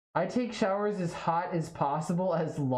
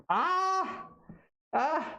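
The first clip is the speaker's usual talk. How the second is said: yelp; 13 semitones higher than usual. Real, not acted. Reacting to a faucet on full hot.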